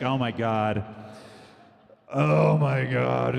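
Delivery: drawn-out slow gasp; slow hushed amazement; awed fish voice. Pretend. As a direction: fish stunned by the news. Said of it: "They're like".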